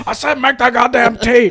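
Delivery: father chasing his son, yelling about the tea; furious dad. Retold or imitated. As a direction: shout it down the hallway after him. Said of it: "super deep-voiced Vietnamese man".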